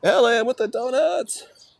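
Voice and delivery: very high, slow thanks; excited shout-out. Real, not acted. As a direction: sing out the name. Thanking gifter on live.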